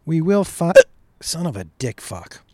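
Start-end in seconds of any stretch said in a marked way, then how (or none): 1.2-2.4 muttered curse. Real, not acted. Hiccup interrupts, muttered curse.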